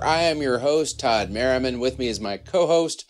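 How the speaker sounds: usual speaking voice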